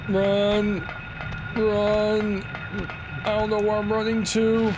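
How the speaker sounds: high, frantic urging to run; panicked urgency. Real, not acted. Fleeing monsters in horror game.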